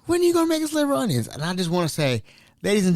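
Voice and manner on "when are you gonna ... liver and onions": very high, fast, giddy; excited residents. Pretend. Residents asking the chef excitedly, very high and fast. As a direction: squeal it out like thrilled old folks. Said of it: "all excited, like".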